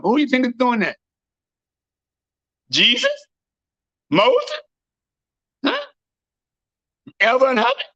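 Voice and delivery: high, shouted street sermon; preaching at full force. Acted. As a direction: thunder at the crowd from the pulpit. Preaching to the crowd.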